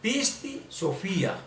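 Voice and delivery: slow "Pisti Sofía", two separate words, stressed FÍ; demonstrated pronunciation. Shown as a demonstration. Teacher repeating the translator's audio, slow and careful. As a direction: repeat "Pisti Sofía" deliberately, stretched, accent on the í. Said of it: "que se pronuncia así".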